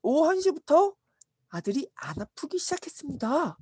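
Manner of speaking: high-pitched, eager announcement; reporting servant. Acted. Servant reports the hour of healing, high eager voice. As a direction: pipe up with the answer.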